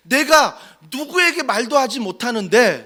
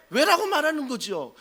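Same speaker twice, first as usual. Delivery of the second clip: ordinary delivery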